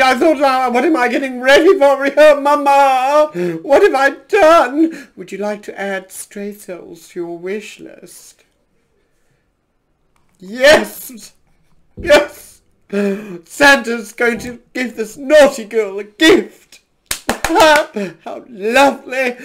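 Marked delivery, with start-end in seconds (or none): 0.0-5.1 high, loud alarmed outburst; panicked exclamations. Real, not acted. Panicked reaction to game event. 10.4-19.4 loud, delighted yells ending in laughter; gleeful shouting. Real, not acted. Screaming yes at wish list prompt.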